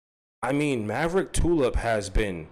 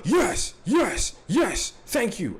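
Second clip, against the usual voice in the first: high, gasped cries of triumph; relieved celebration; 9 semitones higher, much slower than usual. Real, not acted. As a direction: celebrate each word in a high burst, then draw out the thanks. Celebrates a bingo board success.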